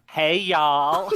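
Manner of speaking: high, hammed-up Southern holler; mock Southern accent. British co-host retells winning a stage prize, mock Southern accent. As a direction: throw out a comically Southern greeting. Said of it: "I just instantly went Southern".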